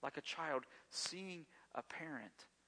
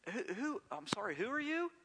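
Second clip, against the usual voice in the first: confused caller; 8 semitones higher, 4 dB louder than usual. Pretend. Mock confused phone answerer.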